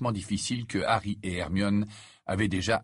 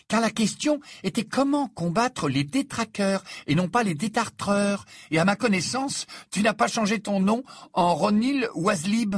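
high-pitched schoolgirl voice; exacting Hermione, pointing out mistakes; 11 semitones higher, 3 dB louder than usual. A narrator's reading. Hermione lists Ron's mistakes.

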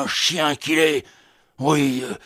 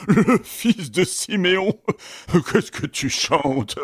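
en riant. Acted. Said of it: "gaieté formidable".

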